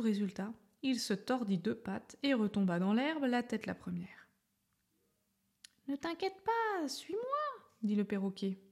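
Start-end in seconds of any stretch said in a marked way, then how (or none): 5.9-7.2 high bright bird voice, reassuring; a friendly parrot calming his friend. A narrator's reading. Parrot reassuring the fallen elephant, high bird voice.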